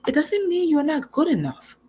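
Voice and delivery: breathy high reassurance, no voice; gently, under the breath, comforting; reassuring. Real, not acted. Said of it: "reassuring the listener".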